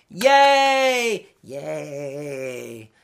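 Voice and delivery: sarcastic cheer that fades; mock cheer. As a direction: cheer once with fake glee, then let it sag. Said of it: "mock cheering crowd".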